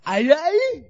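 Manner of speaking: put-on high, loud sloppy drunk call; drunk slurred opener. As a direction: slur it out like a wasted comic onstage. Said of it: "I started something like".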